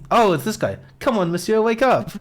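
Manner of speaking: fisherman voice. Acted.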